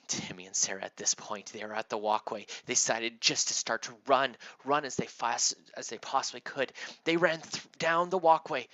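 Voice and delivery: ordinary delivery, nothing marked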